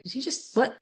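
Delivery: high, hushed mock disbelief; stunned disbelief. Retells stunned reaction to doctor.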